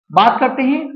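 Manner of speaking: usual speaking voice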